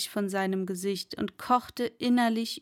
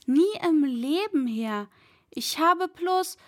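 higher, hurried plea to his master; frightened protest; 6 semitones higher than usual. Acted. Servant protesting his innocence to the angry master, voice high and frightened. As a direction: cut off while pleading his innocence.

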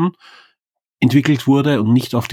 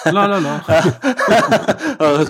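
sing-song la la la through a laugh; 8 semitones higher, much slower than usual. Real, not acted. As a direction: toss off a giggly la la la.

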